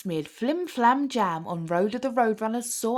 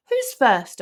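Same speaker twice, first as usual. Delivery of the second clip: cheerful; 4 semitones higher, 8 dB louder than usual. A narrator's reading. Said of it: "Doctor Pete asked cheerfully".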